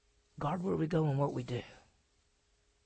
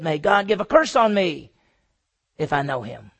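mock oath; 10 dB louder than usual. Peter calling curse on himself.

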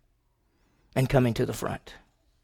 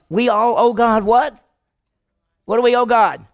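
loud preaching question; 8 semitones higher, 7 dB louder than usual. Real, not acted. Preacher quizzing the congregation.

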